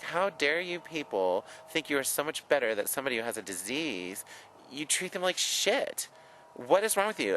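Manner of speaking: rising outraged rant, pitch climbing high; furious, scolding the people who shunned him; climbing into an outraged, high-pitched rebuke; angry. Retold or imitated. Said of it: "It made me angry".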